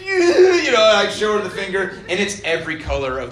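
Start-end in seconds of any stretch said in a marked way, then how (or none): 0.0-0.8 high, loud mock sob with a laugh; imitated crying. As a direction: ham up a kid's howl of pain, half laughing. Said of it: "So I'm like".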